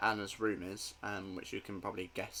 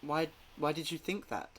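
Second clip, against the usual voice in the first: higher, halting, bewildered; confused stammer; 6 semitones higher than usual. Acted. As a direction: stumble over words, uneasy.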